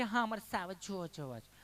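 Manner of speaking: a lightly voiced visitor, crisp careful Gujarati; prim city visitor. City visitors ask the storyteller to see lions, mock prim. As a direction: imitate a townsman picking each word neatly. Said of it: "Gujarati ચીપી ચીપીને બોલે".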